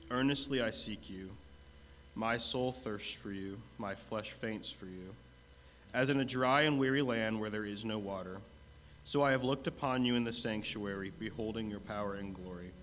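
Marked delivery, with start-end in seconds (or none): none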